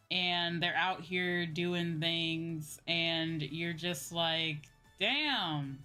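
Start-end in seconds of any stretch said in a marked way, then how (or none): none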